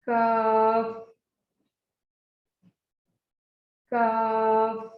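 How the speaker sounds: काफ and काफ each stretched long and slow; drilled letters. Shown as a demonstration. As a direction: drill काफ slowly for the class. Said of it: "आप खुद पढ़े तो". (काफ धीरे धीरे खींच कर पढ़ें)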